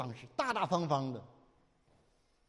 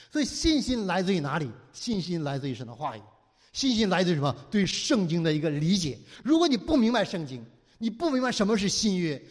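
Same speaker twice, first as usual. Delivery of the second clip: normal talking voice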